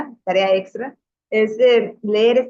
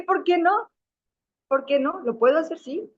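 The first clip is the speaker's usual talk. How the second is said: higher playful provoking; teasing challenge; 8 semitones higher than usual. Retold or imitated. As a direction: tease them with the question. Provoca a las muchachas. (desafío juguetón, agudo)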